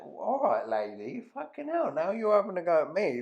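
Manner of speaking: exasperated retort with high squeaks; mock indignant. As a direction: pitch leaping high in protest. Acted indignant retort to shop lady.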